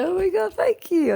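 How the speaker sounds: high, loud squeal of gratitude; delighted high-pitched retold thank-you; gushing thanks. Retold thrilled thanks for a compliment.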